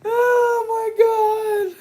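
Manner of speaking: loud, high, drawn-out wailing; hysterical wail. Real, not acted. Reacting to Andrew's joke, loud hysterical wail. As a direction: wail slowly, overcome with laughter.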